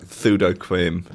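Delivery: slow, pseudo said as thudo; lisp. Retold or imitated. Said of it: "it's just good with a lisp".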